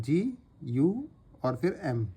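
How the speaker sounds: slow, spaced "जी, यू और फिर एम"; slow spelling out. Shown as a demonstration. Teacher spelling out the strokes slowly for students. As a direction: spell each letter slowly and clearly.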